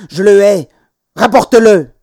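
put-on loud, high childish outburst; furious protest. A narrator's reading. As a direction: shout it in angry defiance. Child's quarrel escalating.

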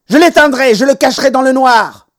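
loud, high raging threat; yelled. A narrator's reading. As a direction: scream the threat like a furious child. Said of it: "hurla-t-il".

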